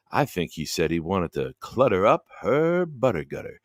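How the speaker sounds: late night radio host. Acted. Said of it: "you gotta let me do shtick".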